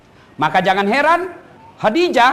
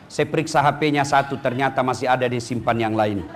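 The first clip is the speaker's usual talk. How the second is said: low, soft pleading prayer voice; humble prayer; 8 semitones lower, 3 dB quieter than usual. Retold or imitated. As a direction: pray quietly like a worried wife. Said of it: "bangun menghadap kepada Allah".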